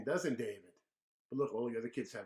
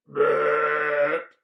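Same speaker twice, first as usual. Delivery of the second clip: loud long belch; big loud burp; 15 dB louder than usual. Acted. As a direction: belch it out rudely. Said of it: "a big giant burp".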